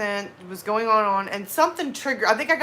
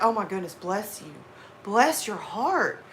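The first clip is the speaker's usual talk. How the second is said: sincere sympathy; 4 dB quieter than usual. Shown as a demonstration. Blessing someone before any sarcasm, sincere. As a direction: say it gently, as if truly sorry. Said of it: "when they get into that sarcasm".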